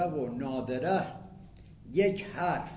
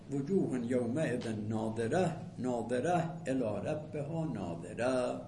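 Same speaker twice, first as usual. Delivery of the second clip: Quran recitation; 4 semitones lower than usual. Shown as a demonstration. Teacher recites verse again.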